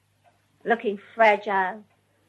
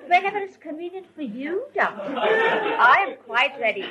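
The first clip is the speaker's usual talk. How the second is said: higher, mock deferential phone voice; lightly imitating an overly polite tone for doctors; doctor's voice; 8 semitones higher than usual. Gohar on the phone to the doctor, mock deferential and higher. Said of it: "she was talking to the doctor in that doctor's voice".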